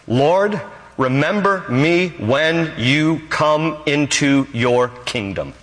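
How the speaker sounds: slow, word by word; measured plea. Retold or imitated. Thief pleading to Jesus from the cross, measured plea. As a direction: let each word of the plea stand apart.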